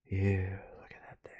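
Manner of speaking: hushed whisper at a creepy image. Real, not acted. Looking at a creepy painting, whispered. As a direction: breathe it out, almost no voice. Quiet awe at creepy art.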